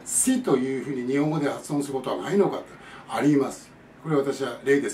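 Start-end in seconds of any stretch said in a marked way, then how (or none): 0.1-0.4 higher し as English "see", no "sh"; S sound. Shown as a demonstration. Teacher asking about Japanese sounds, し said as "see". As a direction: keep a hissed clean "s" in し. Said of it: "じゃあ".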